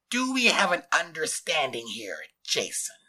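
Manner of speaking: strangled, threatening question; loud scratchy ultimatum; demanding scratchy voice. A narrator's reading. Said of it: "demanding, scratchy voice".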